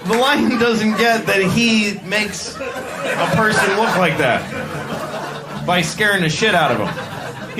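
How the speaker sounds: ordinary talk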